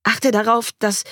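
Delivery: hurried high instruction, cut short; speaking fast. A narrator's reading. Mother instructing her husband, hurried and breaking off. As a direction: start urgently, then break off. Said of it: "Ich begann, schnell zu sprechen".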